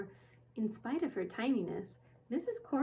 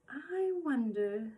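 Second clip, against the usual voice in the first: dreamily; 4 semitones higher, much slower than usual. Acted. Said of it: "she said dreamily".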